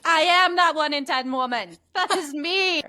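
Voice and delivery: indignant retort. Retold or imitated. Patient answering the physician, indignant and loud.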